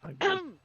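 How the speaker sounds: loud throat clearing. Real, not acted.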